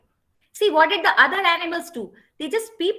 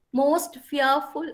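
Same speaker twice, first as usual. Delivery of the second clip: ordinary delivery, nothing marked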